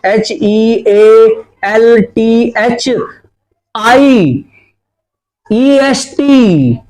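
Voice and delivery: letters spelled out very slowly one by one; dictating letters at a crawl; slow spelling out. Shown as a demonstration. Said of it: "मैं भी बना देता हूँ".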